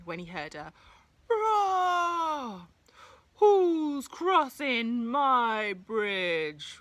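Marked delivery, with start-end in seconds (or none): 1.3-2.7 high, loud roaring cry; troll roar. Acted. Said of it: "roared the troll". 3.4-6.8 slow, drawn-out, loud troll voice; growling troll. Acted. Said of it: "roared the troll".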